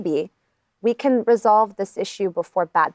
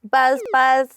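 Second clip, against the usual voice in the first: bee voice; 5 semitones higher than usual. Acted.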